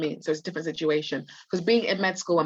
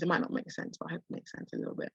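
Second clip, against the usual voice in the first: hoarse, failing voice fading to a whisper; losing voice; 10 dB quieter, faster than usual. Real, not acted. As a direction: voice giving out, barely there. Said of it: "I'm literally losing my voice".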